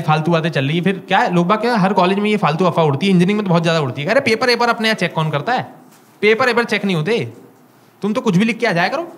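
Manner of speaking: ordinary talk, nothing marked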